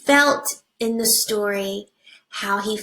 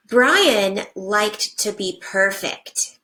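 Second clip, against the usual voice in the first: put-on reading to children. Teacher reading a picture book to her class, animated storybook voice.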